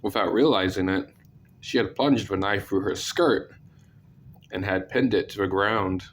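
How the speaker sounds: usual speaking voice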